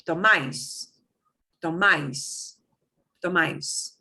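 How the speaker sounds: tomais with a short to and punched MAIS, falling; stress on last syllable. Shown as a demonstration. Teacher showing where the accent mark breaks the rule, final stress on tomais. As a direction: hit the MAIS at the end of tomais each time. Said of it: "punch it harder".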